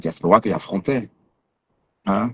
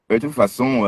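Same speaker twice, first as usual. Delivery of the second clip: normal talking voice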